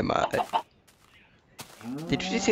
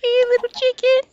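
very high, loud cartoon squeak calling the chicken; squeaky silly voice; 8 dB louder than usual.

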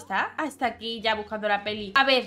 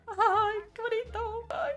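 much higher, gasping with delight; excited squeal; 10 semitones higher, slower than usual. Real, not acted. Player sees a forehead kiss, excited squeal. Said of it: "Estoy emocionada".

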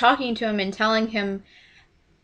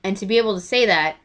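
ordinary talk, nothing marked

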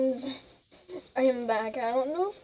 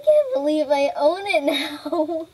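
thrilled; 3 semitones higher, 11 dB louder than usual. Real, not acted. Finally sees her horse.

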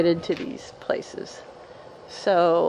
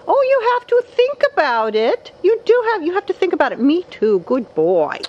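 put-on very high squeaky baby-talk voice; 12 semitones higher, 5 dB louder than usual. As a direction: squeal it like talking to a pet. Answering for parrot in high voice.

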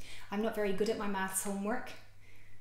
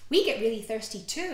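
usual speaking voice

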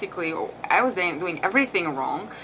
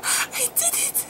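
tearful joy; 5 dB quieter than usual. Retold or imitated. New mother just after pushing baby out, tearful joy. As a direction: squeak it out as if crying with joy. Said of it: "And I go crying".

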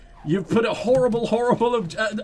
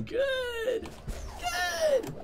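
squeaky good; 10 dB quieter than usual. Acted. Squeaky praise after a jump.

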